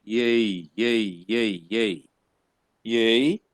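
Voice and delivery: "Yay" repeated slowly as a sound drill; sound demo. Shown as a demonstration. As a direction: demonstrate the "Yay" sound again and again. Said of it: "it's an H sound".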